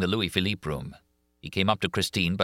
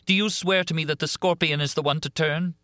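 Christine pleading; 10 semitones higher than usual. A narrator's reading. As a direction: beg him to swear, desperate. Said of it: "cried Christine".

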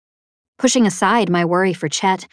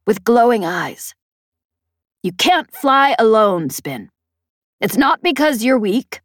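higher, stern commander tone; commanding officer voice; 5 semitones higher, 4 dB louder than usual. A narrator's reading. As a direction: speak like a firm squadron commander. Peg's voice in the story.

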